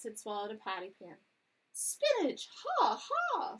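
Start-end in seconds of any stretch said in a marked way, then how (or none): 1.7-3.6 high, loud cackling bird call; a loud, high magpie squawk; magpie doctor. A narrator's reading. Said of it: "said he".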